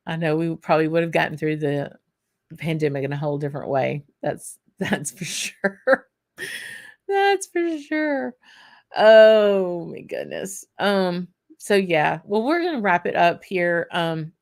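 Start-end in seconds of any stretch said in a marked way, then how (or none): 4.2-6.1 chuckling through the words; laughing. Real, not acted. Joking about Amazon delivering liquor. 7.1-8.3 much higher, slow, jokey repeat; high comic voice. Pretend. 9.0-10.6 comic exclamation. Pretend. Comic exclamation after joke.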